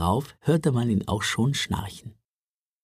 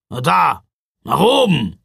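loud, gruff growl; 3 semitones higher, 7 dB louder than usual. A narrator's reading. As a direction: growl it at them. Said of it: "knurrte Ruprecht". (knurrend und laut)

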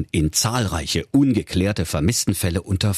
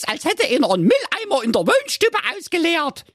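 put-on very high, squeaky girl voice; cheeky young girl. Police girl inspects house.

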